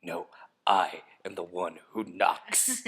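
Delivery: slow, whispered drug-lord menace. Acted. Podcaster showing off an impression to co-host, slow menacing whisper. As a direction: whisper it slowly, playing the TV villain.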